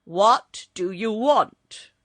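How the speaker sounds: slow, loud demand; loud, stretched-out question; calling out. A narrator's reading. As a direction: call it firmly across to the creatures. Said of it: "called the Shaggy Man".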